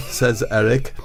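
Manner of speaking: normal talking voice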